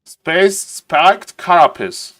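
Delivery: "spiked carapace" very slow, with a strong aspirated "k" in "carapace"; mock translator accent. Shown as a demonstration. Streamer mimicking the translator's accent, slow and exaggerated. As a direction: drag the phrase out in the machine's accent. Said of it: "The accent".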